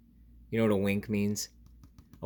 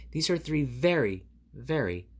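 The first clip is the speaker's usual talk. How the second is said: ordinary delivery, nothing marked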